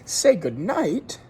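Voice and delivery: high, loud, slow "Say goodnight?"; surprised family. A narrator's reading. Family astonished their guest wants to go, high and loud. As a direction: gasp at the idea he'd leave. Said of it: "they said".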